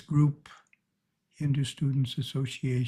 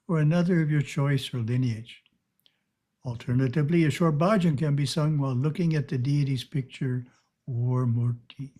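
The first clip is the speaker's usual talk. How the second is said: ordinary delivery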